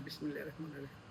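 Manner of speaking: low murmured blessing before eating; quiet murmur. Real, not acted. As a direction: murmur the bismillah softly under breath.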